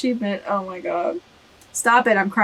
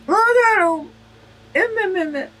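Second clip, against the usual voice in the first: fond coo; 9 semitones higher than usual. Real, not acted. Gushing over character picture.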